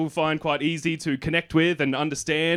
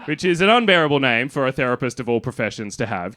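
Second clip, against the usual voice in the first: normal talking voice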